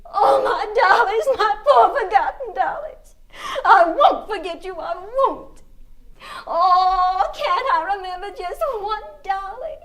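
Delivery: tearful sobbing. Acted. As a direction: voice climbs high and breaks into sobs. Child weeping over forgotten dolls.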